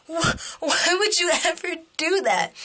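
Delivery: high, half-laughing mock outrage; dismayed protest. Speaker to man who kicked little crocodile, dismayed. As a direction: wail the question high with a laugh in it.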